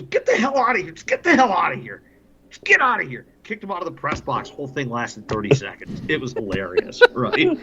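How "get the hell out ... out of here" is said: high-pitched imitation of ordering someone out; comically exasperated, pitched way up; mock angry outburst. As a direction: act out a worked-up guy throwing someone out. Retold kicking Ruggs out of press box.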